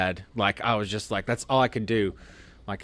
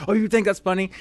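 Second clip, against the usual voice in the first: mocking sergeant; 10 semitones higher, faster than usual. Sergeant mocking laughing recruit.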